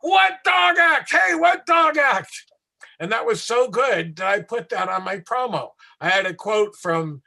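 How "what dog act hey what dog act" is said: high, loud, slow panicked yell; yelling over shoulder. Retold or imitated. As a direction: yell it back over your shoulder in horror. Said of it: "he's yelling over his shoulder".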